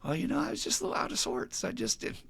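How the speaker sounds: high, softened imitation of an excuse; mock downplaying. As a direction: play it down in a small, high voice. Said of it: "Even Dan saying".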